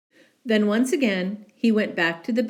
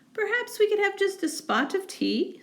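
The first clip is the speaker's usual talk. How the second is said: much higher, polite little mouse; tiny mouse voice. Acted. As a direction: squeak it politely. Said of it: "said the mouse".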